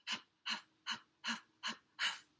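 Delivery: unvoiced jogging puffs, soft; breathy huffing. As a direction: breathe the huffs without voice.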